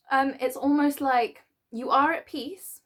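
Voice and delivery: ordinary delivery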